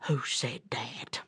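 very low, soft, slow bear voice; puzzled old bear. Acted. Baffled bear looks around for the caller, low slow voice. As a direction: ask it slowly from down low. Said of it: "and he said".

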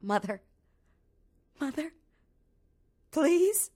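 pleading, rising to a high please; a lost child begging her mother; crying out. Acted. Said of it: "she cried out".

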